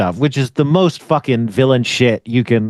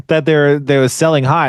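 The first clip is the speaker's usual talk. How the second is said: usual speaking voice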